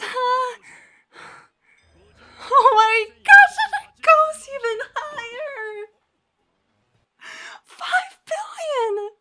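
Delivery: overwhelmed and near tears; tearful excitement. Real, not acted. Reacting to higher bounty.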